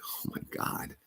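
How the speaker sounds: hushed, breath-only imitation of someone near tears; almost crying. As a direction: imitate a distraught man, barely voiced. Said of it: "almost starts crying, like".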